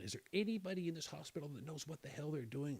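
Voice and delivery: normal talking voice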